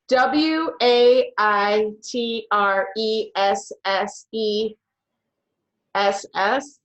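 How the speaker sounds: very slow, letter by letter; slow spelling. Acted. Contestant at a spelling bee, spelling slowly letter by letter. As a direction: spell each letter with long gaps.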